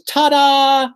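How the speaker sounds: high, bright showman's "Ta-da!"; triumphant ta-da. Pretend. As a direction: land the trick and present yourself. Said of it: "and goes".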